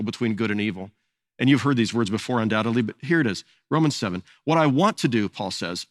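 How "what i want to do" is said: measured, earnest Bible reading; solemn reading aloud; scripture reading. Acted. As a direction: read the verse to the congregation. Reads Romans seven aloud.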